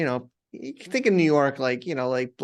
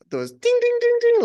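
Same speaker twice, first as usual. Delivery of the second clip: imitated bell ding; 6 dB louder than usual.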